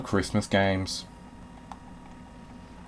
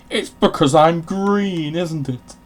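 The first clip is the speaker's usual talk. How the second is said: higher, louder, slow put-upon whine; mock indignant; 8 semitones higher, 8 dB louder, slower than usual. Gamer as Grinch whines at attackers, mock indignant. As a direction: play the wounded victim, drawn out.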